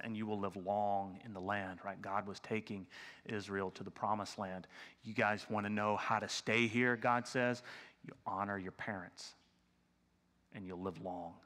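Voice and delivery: low, soft, unhurried promise; hushed voice of God. Acted. Preacher voices God's promise to Israel, soft and low.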